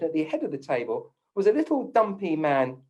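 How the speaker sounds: ordinary talk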